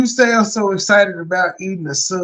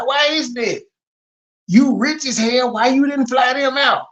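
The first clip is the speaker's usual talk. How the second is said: ordinary talk, nothing marked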